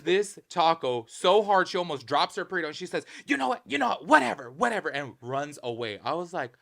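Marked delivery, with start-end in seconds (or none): 3.3-4.9 higher and louder huffy brush-off; imitating a rude customer storming off; snippy snap. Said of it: "she snapped on him".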